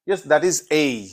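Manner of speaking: normal talking voice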